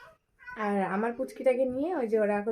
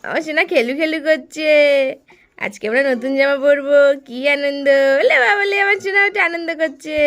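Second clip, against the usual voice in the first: excited cooing; 5 semitones higher, 14 dB louder than usual. Real, not acted. Mother delights in her playing child, cooing loudly.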